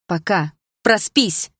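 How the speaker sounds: angry snap. Acted. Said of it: "вспылила я".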